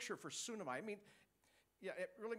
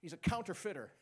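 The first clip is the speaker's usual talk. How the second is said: ordinary talk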